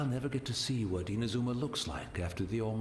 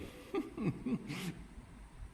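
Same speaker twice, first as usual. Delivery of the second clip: soft chuckle; 8 semitones higher, 5 dB quieter than usual. Real, not acted. Old man amused at himself.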